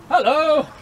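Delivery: ordinary delivery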